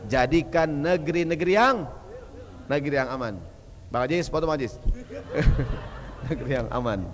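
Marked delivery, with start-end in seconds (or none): none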